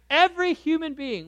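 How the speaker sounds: every human being, high and loud; preacher's ringing emphasis; emphatic. Real, not acted. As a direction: drive it home to the room. Preacher stresses to congregation.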